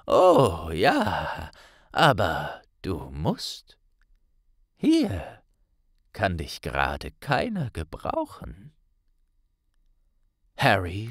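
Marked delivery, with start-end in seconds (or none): 0.0-8.7 put-on low, slow old wizard voice; old headmaster calmly insisting; calm Dumbledore. A narrator's reading. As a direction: insist kindly but firmly.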